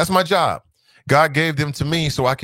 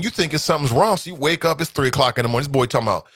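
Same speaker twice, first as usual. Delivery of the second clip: ordinary talk, nothing marked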